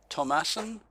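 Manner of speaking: usual speaking voice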